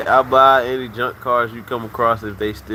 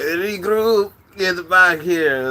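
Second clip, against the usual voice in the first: higher, slow barked order to the troops; mock commander call; 6 semitones higher, 3 dB louder, much slower than usual. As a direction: call the squad back like a game leader. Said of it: "he be like".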